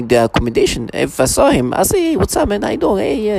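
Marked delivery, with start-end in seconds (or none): 2.1-3.4 much higher, upbeat "what's up, man"; cheery imitated guy greeting, pitched up; friendly bro greeting. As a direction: imitate a casual buddy hello. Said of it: "talking like that".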